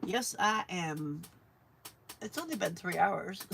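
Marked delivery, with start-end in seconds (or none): none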